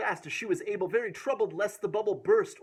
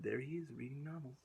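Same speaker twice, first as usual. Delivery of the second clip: quiet aside; 2 semitones lower, 11 dB quieter than usual. Real, not acted. Quiet aside showing picture.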